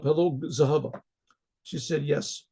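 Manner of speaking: plain reading voice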